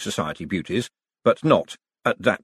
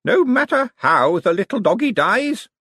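put-on old lady voice; 13 semitones higher, 4 dB louder than usual. A narrator's reading. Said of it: "said Aunt Carefulness Lavish".

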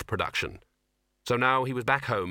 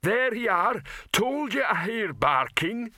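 much higher, slow character voice; raised, far-carrying rescuer's voice; Rock; 11 semitones higher than usual. A narrator's reading. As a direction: call out slowly, pitched up. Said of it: "said the voice of Rock".

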